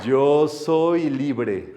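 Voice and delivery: very slow and stretched out; slow, stretched affirmation; drawn-out affirmation. Acted. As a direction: savour "libre" slowly.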